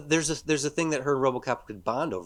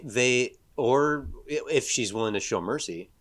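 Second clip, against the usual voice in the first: usual speaking voice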